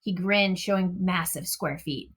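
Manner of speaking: ordinary delivery, nothing marked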